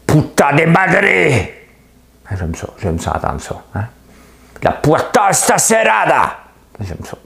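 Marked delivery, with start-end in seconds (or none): 0.0-1.5 drawn-out high, loud Spanish cry; Spanish accent. Retold or imitated. Showing off the Spanish accent from the series, high Spanish shout. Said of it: "l'accent espagnol". 4.6-6.3 high, loud, slow Spanish exclamation; Spanish accent. Retold or imitated. Imitating the series heard in Spanish, high Spanish shout. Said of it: "l'accent espagnol".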